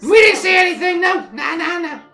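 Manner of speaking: very high, loud squeaky chatter; squeaky halfling. Acted. Fleeing halfling answers travellers' questions, squeaky and loud. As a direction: talk loudly in a tiny, squealing voice. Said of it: "this kinda squeaky voice like".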